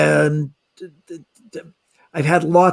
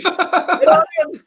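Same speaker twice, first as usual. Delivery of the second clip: very high, slow, laughing "get on in"; hammed-up boatman; much slower than usual. Boatman waving Sean aboard, hammed-up high slow voice. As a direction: stretch it out like a goofy cartoon boatman.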